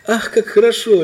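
high, loud burst of joy; jubilant. A narrator's reading. Prince rejoices at finding his friend, jubilant. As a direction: exult out loud. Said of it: "ликовал он".

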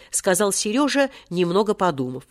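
usual speaking voice

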